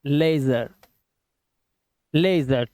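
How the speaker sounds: लेजर low and measured, lay-zer; teacher drilling लेजर, ay not ee; correct pronunciation. Shown as a demonstration. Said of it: "इसको पढ़ना है लेजर".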